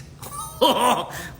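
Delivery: high burst of laughter; real laugh. Real, not acted. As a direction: laugh out loud, delighted.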